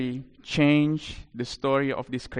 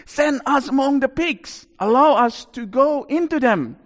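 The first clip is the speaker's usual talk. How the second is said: high-pitched demonic plea; high, insistent begging; 10 semitones higher, 4 dB louder than usual. Acted. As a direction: plead urgently as a demon. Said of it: "the demons begged Jesus".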